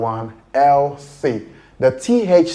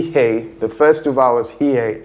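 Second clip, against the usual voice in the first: ordinary talk, nothing marked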